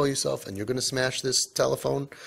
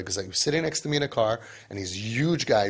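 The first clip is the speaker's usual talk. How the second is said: usual speaking voice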